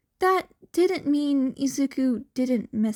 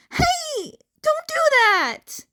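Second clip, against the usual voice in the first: high, louder pleading cry; panicked protest; 5 dB louder than usual. A narrator's reading. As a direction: stop her in a panic. Izuku protests friend calling Shinso.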